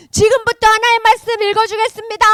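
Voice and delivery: high, loud public proclamation; grand announcement. Acted. Priest telling the assembled people he will now read, proclaiming. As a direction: announce it to a whole crowd.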